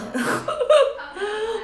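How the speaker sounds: sudden squealing laugh at herself; high burst of laughter. Real, not acted. Laughing at herself for forgetting her money. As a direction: laugh it out, caught without cash.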